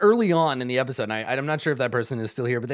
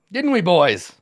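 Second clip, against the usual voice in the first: put-on loud, raised-pitch call to the boys; rallying the gang; 4 semitones higher, 8 dB louder, slower than usual. Goblin boss seeks his gang's agreement, rallying. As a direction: throw it out to your henchmen.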